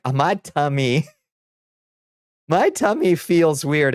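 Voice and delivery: hammed-up queasy complaint; mock tummy ache. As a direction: play up the tummy ache with a laugh. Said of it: "it's like".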